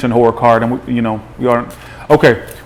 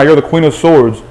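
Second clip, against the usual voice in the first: ordinary delivery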